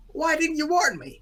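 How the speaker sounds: very high clown complaint; mock outraged wail. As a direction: cry out in high-pitched comic protest. Voices clown flung across the stage.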